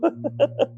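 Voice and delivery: light high laugh; brief real chuckle. Real, not acted. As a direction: laugh out mid-sentence. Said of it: "I'm laughing".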